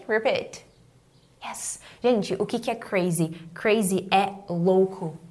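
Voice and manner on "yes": barely audible whisper; hushed little confirmation; whispered approval. Real, not acted. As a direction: breathe an encouraging aside to learners. Teacher softly approves students' repetition.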